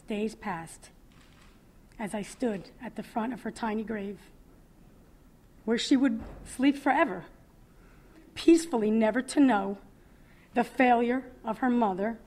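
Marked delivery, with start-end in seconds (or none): 5.7-12.2 louder, aching lament; swelling sorrowful lament; mournful swell. Acted. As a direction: rise into grief over the grave. Dream vision of baby's grave.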